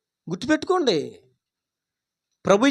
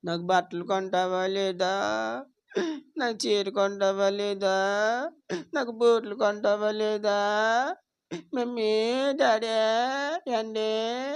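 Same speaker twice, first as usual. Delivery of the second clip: mock crying; 10 dB quieter than usual. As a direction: imitate someone tearfully begging for presents, quietly. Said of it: "దేనికోసం ఏడుపులు మన వాళ్ళందరూనే". (ఏడుస్తున్నట్టు నటిస్తూ మెల్లగా సాగదీసి)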